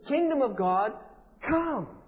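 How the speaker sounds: forceful, insistent summons; fed-up command. Shown as a demonstration. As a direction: demand it loudly, pausing before "come". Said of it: "That's the essence of what's behind here".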